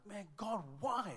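high-pitched cry to God; acted-out anguished why; despairing plea. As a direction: cry out as if hope is gone. Despairing father questioning God.

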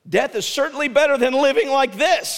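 high, loud despairing cry; exclaiming. Acted. Jonah crying out to God, a high loud exclamation. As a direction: cry out that death is better. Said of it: "he exclaimed".

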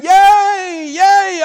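high, loud imitated cheer; retold crowd cheering. Preacher imitating the village crowd, cheering loudly. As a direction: shout it like a whole village cheering. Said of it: "started shouting".

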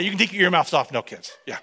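ordinary delivery

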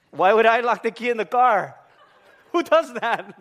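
high, loud mock offence; indignant denial. Guilty husband questioned by wife, hammed-up indignant denial. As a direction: protest too much, high and loud.

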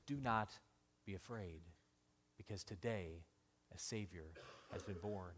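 soft reassurance. Acted. Quoting God's comfort softly.

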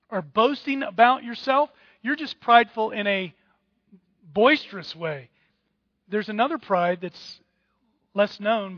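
ordinary talk

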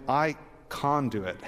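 "can" as "kahn", mock Australian; Australian accent. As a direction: say "can" with a broad Australian "ah", like "con". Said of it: "your very best Australian accent".

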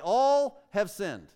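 preacher driving home that everyone has sinned, stressing all. Shown as a demonstration. Preacher to congregation, slow emphatic stress on "All". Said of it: "emphasize a word or two".

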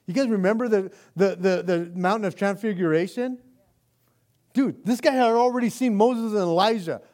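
loud, high, driving sermon voice; full-force preaching. Acted. Pastor preaching Peter's courage to the congregation, booming. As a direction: preach it out with rising fire.

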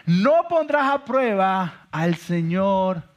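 slow, weighty, every word spaced out; solemn scripture quote. Retold or imitated. Jesus answers the devil, quoting scripture slowly and heavily. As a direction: lay the verse down slowly like a verdict. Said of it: "Las Escrituras también dicen".

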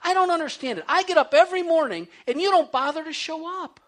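demanding angrily why God doesn't show up; cranky outburst. Retold or imitated. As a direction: throw the complaint at God like a hurt, cranky child. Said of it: "I started getting really cranky".

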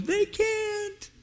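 high, quiet mock divine reply; God's reply. God answering the nation's plea, high and dry. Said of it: "God's going".

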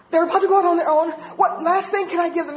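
high, loud, hurried worry; hammed-up last-minute alarm; acted panic. Parent whose children are about to leave, mock panic.

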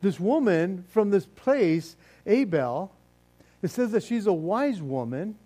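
usual speaking voice

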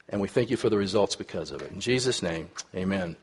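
soft, reverent prayer, fading at the end. Real, not acted. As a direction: pray it quietly to the Father. Opening prayer before sermon.

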